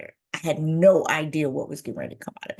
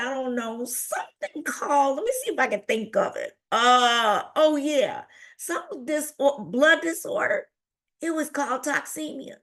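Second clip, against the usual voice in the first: higher, whiny fumbling for the word; flustered upset; 7 semitones higher than usual. Retold or imitated. Daughter recalling diagnosis for mother, flustered. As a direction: grope for it in an upset voice. Distraught daughter trying to remember.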